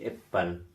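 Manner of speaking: अप्रैल said as एप्पल on purpose; wrong pronunciation. Shown as a demonstration. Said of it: "गलत उच्चारण करिए".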